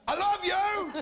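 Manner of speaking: very high, loud yelled declaration; mock bellowed endearment. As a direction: shout the tender line instead of whispering. Whisper in ear parody shouted.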